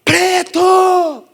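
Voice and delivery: high, drawn-out mock yell; retold yelling. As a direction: yell it out across the street. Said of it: "começou a gritar". (grito agudo e esticado, imitado)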